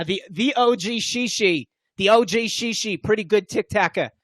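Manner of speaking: put-on light Long Island accent, slow. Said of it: "Long Island accent".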